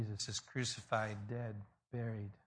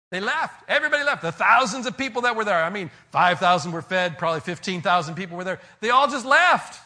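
loud, high, near-shouted preaching; preaching at full force; 8 semitones higher, 18 dB louder than usual. Acted. Preacher telling the congregation everyone walked away, shouting. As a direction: drive it home at the top of your voice.

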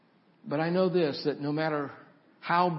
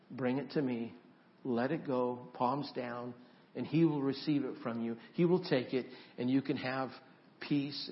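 soft invitation; 4 semitones lower, 7 dB quieter than usual. Acted. Pastor softly inviting the congregation to give Jesus their fears. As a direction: draw them gently toward prayer.

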